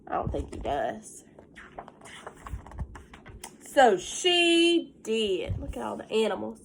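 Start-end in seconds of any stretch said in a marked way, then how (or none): 0.1-1.2 soft whispered aside. Real, not acted. 3.7-5.6 slow refrain. A narrator's reading. Story refrain read slowly.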